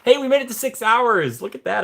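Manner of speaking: high, loud cheer; excited. Real, not acted. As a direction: cheer it out proudly. Celebrating hitting six hours.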